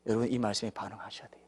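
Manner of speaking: drops to an urgent whisper; sudden whisper. Real, not acted. Preacher whispering to the congregation to respond. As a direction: lean in and whisper the charge.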